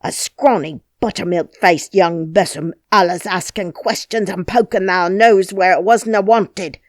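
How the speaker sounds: angry harangue. Acted. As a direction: rant at her, building scorn. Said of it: "he harangued".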